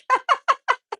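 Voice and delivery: short real giggle; a high sheepish laugh; high embarrassed laugh. Real, not acted. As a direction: burst out laughing at yourself. Laughs at own graphic remark.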